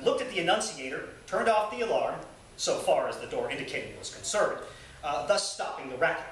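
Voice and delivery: reading a memoir aloud with dry humor; lively, amused reading voice; story narration. Acted. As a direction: tell it like a fireside storyteller. Resumes reading the chapter.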